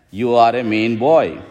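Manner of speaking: normal talking voice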